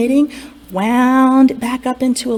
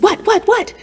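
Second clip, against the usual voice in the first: confused fairies; 11 semitones higher than usual. A narrator's reading. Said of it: "they said".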